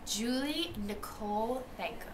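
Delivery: ordinary delivery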